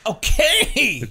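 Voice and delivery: loud, high, drawn-out okay; hammed-up, high-pitched surrender; mock giving in. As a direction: belt out an exaggerated okay. Caving to the inner voice.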